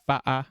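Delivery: butter as "bu'er", no T; sister's dropped T. Retold or imitated. Streamer imitating sister's speech, T dropped. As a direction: say "butter" with a glottal stop for the T. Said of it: "my sister would say".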